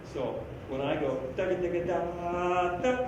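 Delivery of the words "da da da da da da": slow sung da syllables; double tongue demo. Shown as a demonstration. Demonstrating his double tongue to the band, slow sung syllables. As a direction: sing "Da, da, da" slowly, double tongued. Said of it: "that's how I would be doing that".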